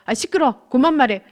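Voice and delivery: retold brusque shushing, slightly loud; irritated husband. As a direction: imitate an annoyed husband cutting someone off. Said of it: "이렇게 말하는 거예요". (짜증 내며 말을 끊는 남편 흉내)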